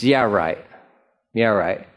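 low, dry disbelief; dismissive. Real, not acted. Preacher doubting the mockers would ever believe, dismissive.

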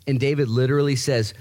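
usual speaking voice

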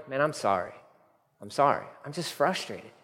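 soft, subdued confession; weary apology. Acted. Preacher voicing his own prayer after yelling, softly contrite. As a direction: admit it quietly to God.

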